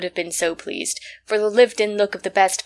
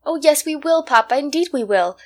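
put-on higher, louder eager children's plea; pleading; 7 semitones higher, 5 dB louder than usual. A narrator's reading. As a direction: beg Papa earnestly. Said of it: "pleaded Elsie and John".

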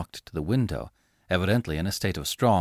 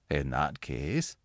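much lower, softer, slow and serious; 8 semitones lower, 7 dB quieter, slower than usual. Acted. Angus answers Laura's nightmare seriously. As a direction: weigh each word seriously. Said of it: "said the man seriously".

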